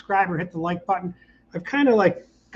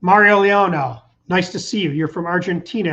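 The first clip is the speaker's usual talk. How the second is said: ordinary talk, nothing marked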